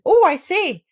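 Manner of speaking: high, loud flustered cry; excited Piglet. Acted. Piglet jumping up and down at the bank, flustered. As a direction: hop about squeaking in alarm. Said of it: "making noises".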